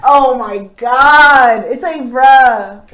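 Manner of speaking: loud, long drawn-out groaning words; fed-up, slow and emphatic reaction; exasperated. Acted. Reacting to a pestering repeat text.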